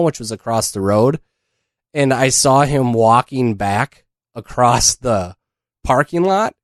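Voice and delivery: usual speaking voice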